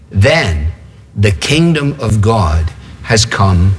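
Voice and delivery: low, slow and weighty, with pauses; solemn pronouncement. Acted. Jesus answers the Pharisees, solemn and slow. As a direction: pronounce it gravely, phrase by phrase. Solemn climax of announced quote.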